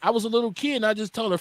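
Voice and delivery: ordinary talk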